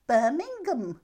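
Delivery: loud, high-pitched imitated Brummie reply; Brummie accent. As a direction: call it out as a mock Brummie. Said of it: "I just don't like the way Brummies speak".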